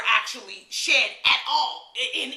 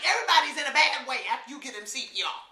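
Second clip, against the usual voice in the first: normal talking voice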